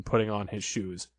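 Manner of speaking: usual speaking voice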